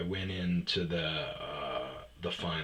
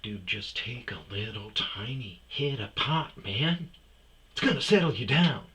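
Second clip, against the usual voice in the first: halting, high-pitched impression, building louder; William Shatner; 6 semitones higher, 6 dB louder than usual. Offering stressed brother-in-law a hit, mock Shatner delivery. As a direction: stretch out the phrases theatrically, rising as it goes. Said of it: "talking like William Shatner".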